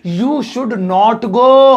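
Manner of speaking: very slow with a long GO; slow, emphatic dictation; drawn-out model sentence. Shown as a demonstration. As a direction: stretch each word out for the class. Teacher reads out English example answer.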